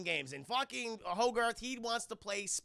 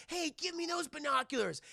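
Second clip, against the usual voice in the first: put-on high child's voice; demanding kid; 9 semitones higher than usual. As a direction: imitate a kid wanting a turn.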